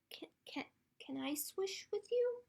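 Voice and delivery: high, hushed, hesitant little plea; timid duckling. Acted. Duckling shyly asks friends to let it join, timid tiny voice.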